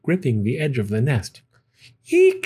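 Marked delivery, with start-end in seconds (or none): 2.1-2.4 high piercing eek of alarm; startled shriek. A narrator's reading. Mama Bird seeing chicks hang upside down, shrieking eek. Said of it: "she cried".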